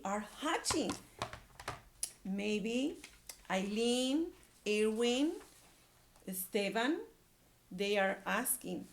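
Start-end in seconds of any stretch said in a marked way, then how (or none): none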